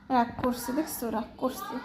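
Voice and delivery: soft murmured aside; hushed aside. Real, not acted. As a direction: drop the voice to a near-hush. Hushed aside about the pet.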